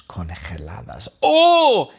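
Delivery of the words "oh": high, loud burst of surprise; surprised oh. Real, not acted. Teller surprised at frozen fries as bullets, loud high oh. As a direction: react as if hit by the absurd idea.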